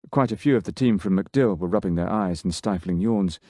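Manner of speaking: ordinary delivery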